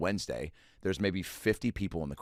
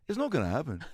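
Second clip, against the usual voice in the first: higher, laughing Liverpool-tinged refusal; 6 semitones higher than usual. Retold or imitated. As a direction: turn him down with a grin, Liverpool-style. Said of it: "just goes".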